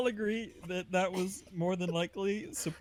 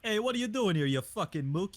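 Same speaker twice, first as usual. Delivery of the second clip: Italian-American wiseguy accent; Italian gangster accent. Shown as a demonstration. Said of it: "like, Italian gangster kinda accent".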